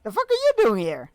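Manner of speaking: loud, high disbelieving shout; shocked. Real, not acted. Player sees Gandalf appear, shouts in disbelief. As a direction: blurt it out in shock.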